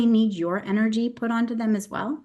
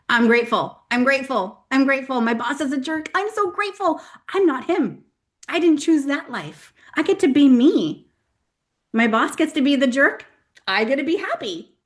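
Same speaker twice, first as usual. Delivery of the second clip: building gratitude mantra; 5 semitones higher, 3 dB louder than usual. Acted. Acting out forced gratitude mantra, building.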